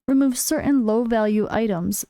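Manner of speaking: ordinary talk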